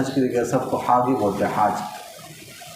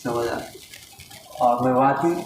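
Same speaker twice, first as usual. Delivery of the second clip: ordinary delivery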